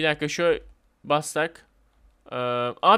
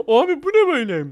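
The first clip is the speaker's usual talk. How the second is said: high, loud startled cry; sudden alarmed exclamation; 11 semitones higher, 6 dB louder than usual. Real, not acted. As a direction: yelp in surprise as the ride lurches.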